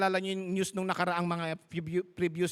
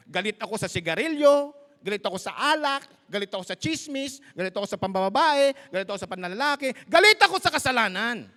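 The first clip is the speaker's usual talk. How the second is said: louder, building preacher's declarations; full-force preaching; 6 dB louder, faster than usual. Acted. Pastor preaching to the church against sin, building loud. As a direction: hammer each sin harder until the last.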